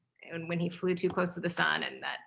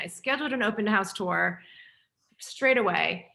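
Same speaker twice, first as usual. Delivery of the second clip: normal talking voice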